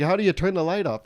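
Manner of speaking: frantic mock plea; panicked husband. Comic voicing the panicking husband on the plane, mock frantic.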